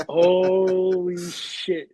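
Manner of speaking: very slow, drawn-out retold groan of dismay; exasperated disbelief. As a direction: stretch HOLY right out in despair. Retells dismay at story following him.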